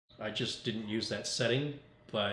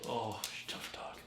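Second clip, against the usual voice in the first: whispered praise; 9 dB quieter than usual. Real, not acted. Owner cooing over his dog.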